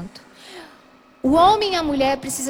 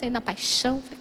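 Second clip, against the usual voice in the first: usual speaking voice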